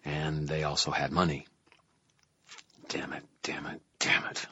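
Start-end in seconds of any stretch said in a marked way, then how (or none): 2.9-4.5 soft, breathy repeated swearing; muttered curse. A narrator's reading. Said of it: "I muttered".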